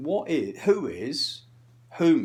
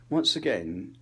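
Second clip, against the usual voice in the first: ordinary talk, nothing marked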